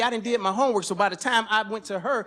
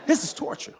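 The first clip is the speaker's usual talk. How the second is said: mock torment. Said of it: "I'm sitting there like".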